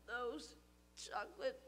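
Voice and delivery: soft, slow moan. Acted. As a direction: moan it with a sore belly. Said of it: "moaned Stuart".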